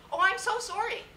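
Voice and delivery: loud, high-pitched mock apology; flustered colleague. Colleague apologising after her gaffe, high flustered mimicry. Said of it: "She says".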